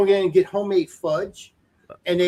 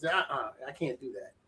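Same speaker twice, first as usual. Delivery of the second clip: a soft, muttered uh-uh; a low-key, softer refusal; quiet refusal; 7 semitones lower, 13 dB quieter than usual. Retold or imitated. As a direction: say it under your breath, softer. Repeating the refusal, much softer.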